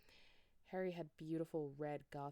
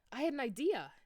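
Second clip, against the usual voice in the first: higher, louder sudden excitement; exclaimed; 7 semitones higher, 7 dB louder than usual. Acted. Vampire getting a brainstorm, higher excited exclaim. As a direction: burst out with the idea. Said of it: "he exclaimed".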